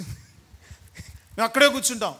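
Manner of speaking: slow, dragged-out mock of a lazy churchgoer; mocking stubborn reply. Preacher mocking worshippers who refuse to come inside, slow stubborn drawl. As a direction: stretch each word of అక్కడే like someone refusing to budge. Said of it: "ఇక్కడ place ఉంది, లోపలికి రండి అంటే".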